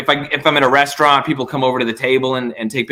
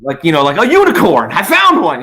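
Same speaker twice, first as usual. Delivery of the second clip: higher, louder mock delight; delighted fan; 4 semitones higher, 4 dB louder than usual. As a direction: gush like finding a unicorn. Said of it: "It's like".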